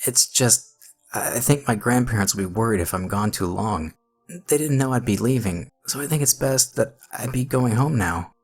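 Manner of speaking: put-on high, hesitant frightened boy; nervous pleading. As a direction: plead timidly to be let go.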